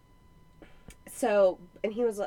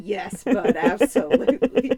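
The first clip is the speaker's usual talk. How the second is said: louder, chuckling through it; laughing reply; 9 dB louder than usual. Retold or imitated. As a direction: answer the boy while laughing. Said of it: "I said".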